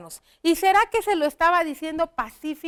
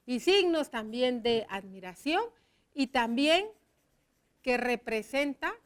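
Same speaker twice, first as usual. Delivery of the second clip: ordinary delivery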